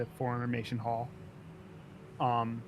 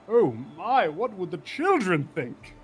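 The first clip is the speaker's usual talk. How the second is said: loud, higher, swooping mock horror; mock scandalized; 6 semitones higher, 7 dB louder than usual. Officer mocking a politician over his affairs, mock scandalized. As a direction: feign shock to taunt a rival. Apollonius mocks Kane's affairs.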